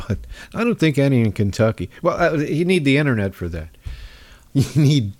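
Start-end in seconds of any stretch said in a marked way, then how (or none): none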